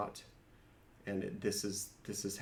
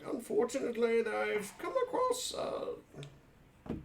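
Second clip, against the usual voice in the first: higher polite adventurer voice to the librarian; character voice; 5 semitones higher than usual. Acted.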